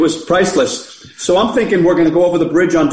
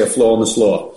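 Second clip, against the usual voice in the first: a light nod to Roscoe, a bit lower; lightly voiced Roscoe quip; Roscoe impression; 5 semitones lower than usual. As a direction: sheriff catchphrase, barely put on. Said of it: "or as Roscoe would say".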